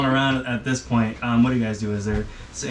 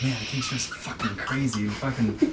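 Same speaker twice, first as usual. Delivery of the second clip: softer grumbling aside; muttered grumble; 5 dB quieter than usual. Acted. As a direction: grumble under your breath. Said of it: "them muddle through, they're like".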